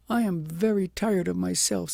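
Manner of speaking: weary princess. A narrator's reading. Princess speaks of her weariness.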